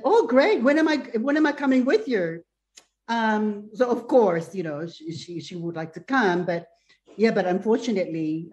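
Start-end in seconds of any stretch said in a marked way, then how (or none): 0.0-2.4 higher-pitched imitation of mom; eager mother. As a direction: invite yourself along as an excited mother. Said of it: "and then she said".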